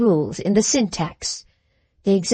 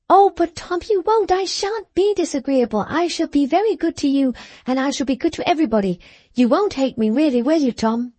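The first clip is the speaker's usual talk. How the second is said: pleading little sister; 5 semitones higher than usual. Acted. Sister pleading with her brother.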